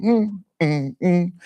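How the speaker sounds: thin, high hums of panic; retold panicked whimpering while hurrying down the mountain; high strained whimpers. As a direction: whimper high through the nose. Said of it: "really just going".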